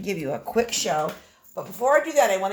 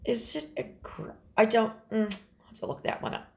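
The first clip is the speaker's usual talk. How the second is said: whispered aside; 6 dB quieter, faster than usual. Real, not acted. Unsure aside to herself.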